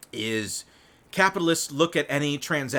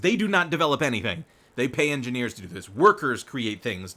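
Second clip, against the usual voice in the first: ordinary delivery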